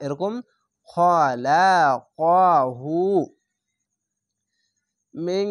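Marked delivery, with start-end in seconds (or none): 0.9-3.0 খলাকাহু drawn out very slowly and louder; long recitation. Shown as a demonstration. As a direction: chant খলাকাহু with a long final hu. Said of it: "মোটা অক্ষর আকার উচ্চারণ হবে না".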